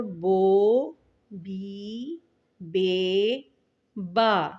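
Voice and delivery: بو، بی، بے، با each blended and held; syllable blending. Shown as a demonstration. Teacher blending ب with the vowels for pupils. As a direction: sound ب with each vowel in turn. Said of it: "ب کو ہم اس سے ملائیں گے".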